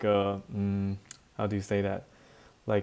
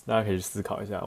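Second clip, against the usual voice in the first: ordinary talk, nothing marked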